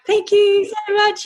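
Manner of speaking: high, drawn-out polite gush; gushing thanks. Retold or imitated. Guest acting out thanking the callers, high gushing thanks. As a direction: imitate an over-sweet, excited thank-you. Acts trying to be cool on call.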